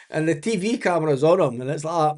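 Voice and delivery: ordinary talk, nothing marked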